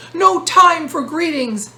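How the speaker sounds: loud, high frantic wail. Acted. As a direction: cry out in a panic. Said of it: "the peddler wailed".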